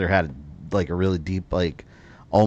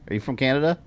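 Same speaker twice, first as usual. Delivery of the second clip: ordinary talk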